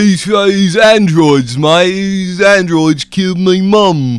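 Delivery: high, loud, slow hammed-up Cockney voice; mock Cockney accent. Co-host mocking the movie character, in a Cockney accent. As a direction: drag out androids in a silly Cockney accent. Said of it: "thick Cockney accent".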